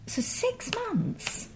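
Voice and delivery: slow, breathless disbelief, pitch way up; drawn out in quiet astonishment; incredulous. Real, not acted. Presenter reacting to the lenient sentence, incredulous.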